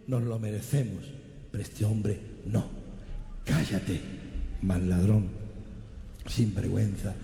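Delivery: a hint of a dying thief scolding the mocker; good thief's rebuke. The good thief rebukes the mocking thief, low and stern. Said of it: "el otro sinvergüenza asesino, dice".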